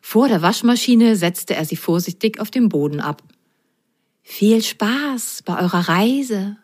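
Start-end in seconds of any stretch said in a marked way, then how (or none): 4.2-6.6 slow, lightly mewed farewell; a hint of a cat's meow; cat wishing the socks a good trip, slow and lightly mewed. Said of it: "maunzte er".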